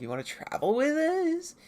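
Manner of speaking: high, bright-pitched friendly invitation; friendly high offer. Retold or imitated. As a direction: ask a new friend along eagerly. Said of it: "Panda's like".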